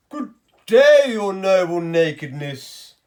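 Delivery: loud, slow grand greeting; bow and flatter the king; a hint of a fawning tailor; pompous tailor. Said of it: "said the first man".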